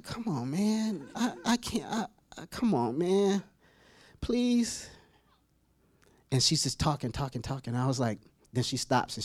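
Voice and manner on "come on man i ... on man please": soft, whiny begging under the breath; hushed, halting mock plea; reluctant pleading. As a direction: plead weakly to be let off. Acts pleading with God.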